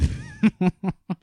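a high, startled burst of real laughter. Real, not acted. Reacting to the co-host's genocide quip with a high laugh. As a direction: caught off guard, laughing up high.

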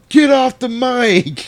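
loud, high, drawn-out heckle; heckler's yell. Retold or imitated. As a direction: yell it like someone at the back of a bar. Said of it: "somebody in the background yell".

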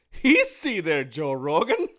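high, loud mocking put-on voice; teasing buddy voice. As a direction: rib your friend about his show. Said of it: "His response on text was like".

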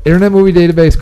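announcer voice. Acted. Announcing the game segment again.

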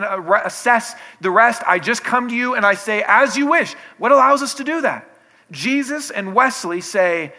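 loud, high fervent surrender cry; full-force preaching. Acted. Preacher voicing a believer's total surrender, loud and fervent. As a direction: throw everything into the plea.

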